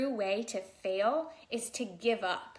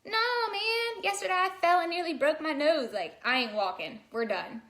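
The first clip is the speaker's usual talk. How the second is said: starts much higher and loud, settling down into a grumble; sulky baby; 6 semitones higher, 4 dB louder than usual. Pretend. Baby refusing to walk after falling, whiny then flat. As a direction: complain like a toddler who got hurt. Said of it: "if babies had this conversation".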